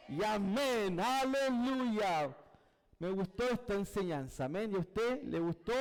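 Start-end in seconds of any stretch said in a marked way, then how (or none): 0.1-2.4 long, drawn-out closing hallelujah; slow, sustained preacher's praise; exultant praise. Real, not acted. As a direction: stretch it out as a triumphant call. Culminating praise after amens.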